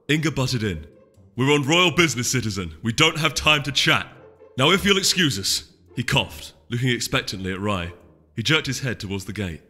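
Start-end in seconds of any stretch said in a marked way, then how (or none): 1.4-5.6 louder brusque official tone; curt soldier. Acted. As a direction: dismiss her with authority. Soldier brushes off citizen.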